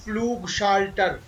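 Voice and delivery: Flugschalter slowly, with long "oo" and long "aa"; elongated vowels. Shown as a demonstration. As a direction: draw out both vowels of Flugschalter. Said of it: "they are all elongated sounds".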